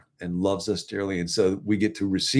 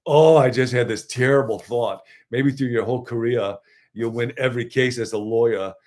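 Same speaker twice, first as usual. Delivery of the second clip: Jersey accent, mock-dramatic and a little louder at the start; 3 semitones higher, 3 dB louder than usual. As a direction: play Marisa Tomei's Jersey accent, lightly. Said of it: "says in that wonderful Jersey accent".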